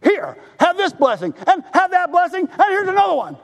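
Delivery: a voiced God, generous and building; God lavishing blessings. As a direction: hand out gifts with rising delight. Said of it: "God goes".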